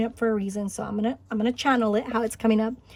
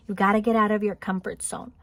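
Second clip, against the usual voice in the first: ordinary delivery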